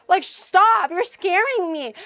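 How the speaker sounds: half-joking frightened cry; mock scared yell. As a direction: beg him to stop, hammed up. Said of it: "I'm like".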